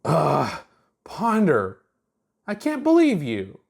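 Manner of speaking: high, exasperated scolding; exasperated girl voice. A narrator's reading. Chuck scolding Ponder, high and exasperated. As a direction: groan it out, fed up with him.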